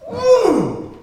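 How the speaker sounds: high loud launching whoop; excited mouse yelp as he leaps; effort cry. Acted. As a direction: push off with a big squeal of effort. Mouse effort before the big jump.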